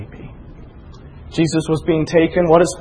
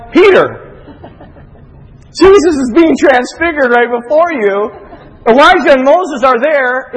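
loud, high-pitched exasperated reproach; mock scolding; 9 semitones higher, 6 dB louder than usual. Preacher telling off Peter at the transfiguration, mock exasperated.

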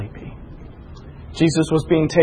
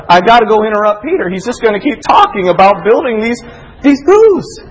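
quick, raised, exasperated complaint; mock exasperation; 5 semitones higher, 5 dB louder than usual. God fed up with Peter's chatter, in mock exasperation. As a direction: rush it, then jump higher and louder.

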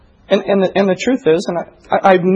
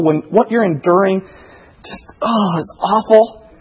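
ordinary delivery

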